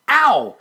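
sudden high loud yelp; yelp of pain. Acted. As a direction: cry out as the fur is pulled. Fur yanked from his tail.

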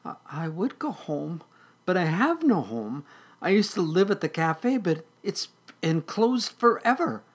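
small, high kitten voice; softly meowing kitten. Acted. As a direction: plead in a high, little kitten voice. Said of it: "softly meowed".

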